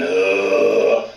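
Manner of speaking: low grunted uh into the call; voiced grunt into the call. Shown as a demonstration. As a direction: push a short low uh from the throat. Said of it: "That's your voice going".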